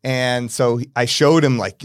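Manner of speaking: ordinary talk, nothing marked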